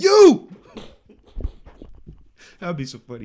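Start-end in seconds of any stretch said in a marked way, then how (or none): 0.0-0.5 loud, high yell; shouted accusation. Retold or imitated. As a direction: yell it out, pointing. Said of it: "and said".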